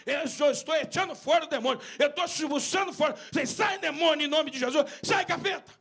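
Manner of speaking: preacher shouting at the demon in Jesus' name; shouted exorcism. Retold or imitated. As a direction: cast it out at full voice. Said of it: "Eu falei".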